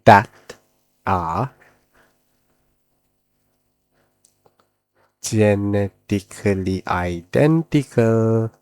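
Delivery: very slow, long pauses between words; drawn-out words, paced for note-taking; slow dictation. Shown as a demonstration. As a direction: spell out the definition word by word. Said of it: "let's take the definition slowly".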